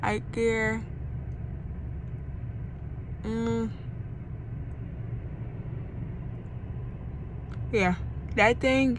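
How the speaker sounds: talking with finger in mouth; lip pulled aside by finger. Real, not acted. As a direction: mouth stretched open. Seen 0: finger pulls mouth corner open throughout, showing braces.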